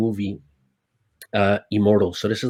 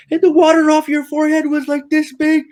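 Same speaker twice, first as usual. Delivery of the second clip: high, loud astonished voice; amazed crew; 6 dB louder than usual. Retold or imitated. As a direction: marvel like the crew. Crew excitedly describing the wake.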